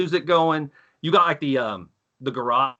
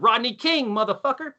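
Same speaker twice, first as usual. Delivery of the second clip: mock taunt; 9 semitones higher than usual. Taunting cops at party.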